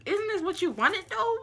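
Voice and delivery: high-pitched baffled challenge; incredulous comeback. Retold or imitated. Retold texting argument, baffled comeback.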